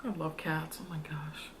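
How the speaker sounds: soft unvoiced whisper. Real, not acted. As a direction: breathe it out as a whisper. Whispered aside about cats.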